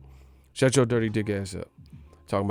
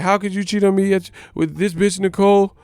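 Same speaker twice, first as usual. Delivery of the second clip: high mock girlfriend voice; girlfriend accusing; 6 semitones higher, 3 dB louder than usual. Girlfriend naming Nicole the manager, high mock voice. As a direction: squeal it like the betrayed girlfriend. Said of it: "She's like".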